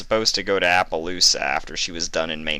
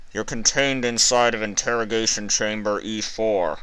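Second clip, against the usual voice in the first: a higher, even voice over an intercom; cold speaker voice; 5 semitones higher than usual. A narrator's reading. Interrogator over the speaker, cold and detached. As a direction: announce it like a detached official. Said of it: "the voice called out again".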